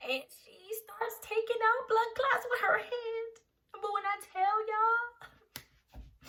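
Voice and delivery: high, strained, held-up pitch; pained disbelief. Real, not acted. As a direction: stretch it out high as if reliving the ordeal. Reliving the worst pain.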